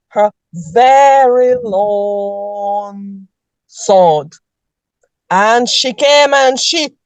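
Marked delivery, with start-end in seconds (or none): 0.1-4.3 dramatic slow. Acted. Dramatic slow sword build-up.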